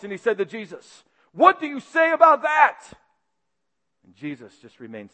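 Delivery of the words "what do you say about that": much higher and louder, furious challenge; raging demand. Acted. High priest confronting Jesus, furious shout. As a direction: hurl the question at him in rage. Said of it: "with rage in his voice".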